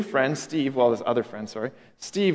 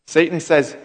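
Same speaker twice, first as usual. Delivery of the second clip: normal talking voice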